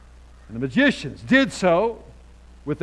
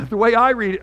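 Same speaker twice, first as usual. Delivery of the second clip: ordinary talk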